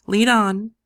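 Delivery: determined call to go in; bold command. A narrator's reading. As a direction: steel yourself and say it. Narrator steels herself.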